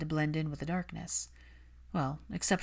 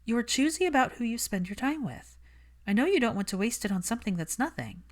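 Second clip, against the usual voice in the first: put-on lightly raised, brisk young woman; Hermione's voice; 6 semitones higher than usual. Hermione brushing off Malfoy, cool and defensive. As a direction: keep her cool and a little defensive.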